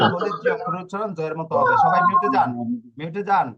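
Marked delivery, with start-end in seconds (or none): 1.8-2.5 sharp high call to the whole class to mute; a teacher calling the class to order. Real, not acted. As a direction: raise the voice high and loud over the noise.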